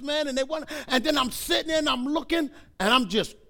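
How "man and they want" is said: usual speaking voice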